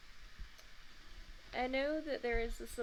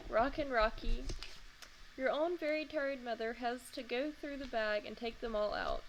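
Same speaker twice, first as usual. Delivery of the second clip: usual speaking voice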